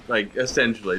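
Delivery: usual speaking voice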